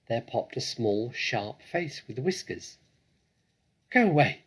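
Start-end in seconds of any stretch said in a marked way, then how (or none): 3.9-4.4 high, loud snappy rat voice; snapping rat. A narrator's reading. Rat snaps at the intruders to go away. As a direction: snap it out like a cornered rat. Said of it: "it snapped".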